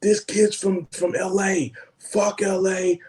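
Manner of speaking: high-pitched ranting New Yorker; mock angry storm-out. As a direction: imitate an indignant crew guy ranting. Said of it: "He storms out".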